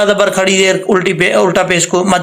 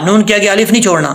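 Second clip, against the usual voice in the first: ordinary delivery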